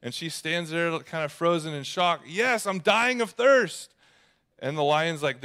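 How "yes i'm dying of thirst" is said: high, loud, drawn-out desperate plea; desperate outburst. Retold or imitated. Thirsty girl answering the lion in shock, desperate. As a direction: act out a parched girl's urgent cry.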